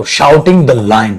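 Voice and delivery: very slow, every word drawn out; slow dictation. Shown as a demonstration. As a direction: spell it out slowly for the class. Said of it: "ऐसे कर दो". (धीरे-धीरे बोलकर लिखवाना)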